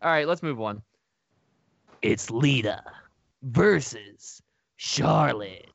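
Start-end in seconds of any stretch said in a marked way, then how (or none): 2.0-5.7 slow, stretched announcer call; versus voice. Shown as a demonstration. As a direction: drag out versus like a ring announcer. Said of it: "I felt like that was worse".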